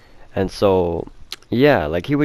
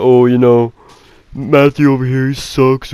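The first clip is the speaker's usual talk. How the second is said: higher, louder mock bully voice; taunting bully; 4 semitones higher, 7 dB louder, slower than usual. Bully putting Matthew down to people, taunting. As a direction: loudly imitate a bully putting someone down.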